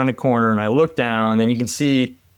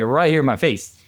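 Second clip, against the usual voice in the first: ordinary delivery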